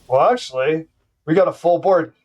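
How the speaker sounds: usual speaking voice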